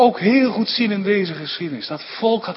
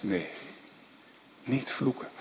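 whispered, much softer divine no; hushed voice of God; 5 semitones lower, 14 dB quieter than usual. Acted. Preacher voicing God's quiet refusal to curse Israel, whispered. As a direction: breathe God's refusal almost inaudibly.

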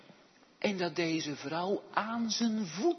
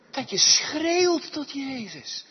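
raised, drawn-out pulpit cry; 12 semitones higher, 11 dB louder, slower than usual. Real, not acted. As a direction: stretch SCHREEUWT high and strong. Preacher echoes the cry. (uitgerekte, luide uitroep)

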